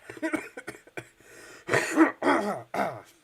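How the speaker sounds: loud belly laugh breaking into a cough; hard laugh into cough. Real, not acted. Hungover rigger laughs too hard at his own joke, coughing. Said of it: "I cannot laugh that hard today".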